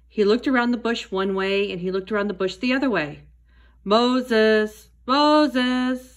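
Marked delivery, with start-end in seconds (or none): 3.8-4.9 loud voice. A narrator's reading. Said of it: "said a loud voice". 5.1-6.1 higher, longer call of the name; a voice calling from the bush; loud calling voice. A narrator's reading. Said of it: "said a loud voice".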